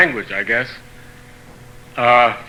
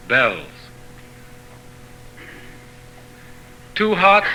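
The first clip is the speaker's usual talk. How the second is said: poetry reading; 2 semitones higher, slower than usual. Acted. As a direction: read each saying in separate phrases, pausing between them. Reading of proverbs continues.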